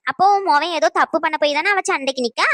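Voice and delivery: normal talking voice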